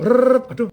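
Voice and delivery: high, loud rolled rrrr, then a mock dismayed aduh; machine whirr. Acting out the teller counting extra money, whirr and mock dismay.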